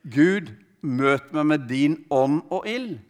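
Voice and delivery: normal talking voice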